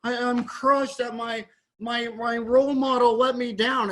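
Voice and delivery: imitating overdramatic public disappointment; mock crushed fan. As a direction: whine like a let-down fan, hammed-up. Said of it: "everybody's like".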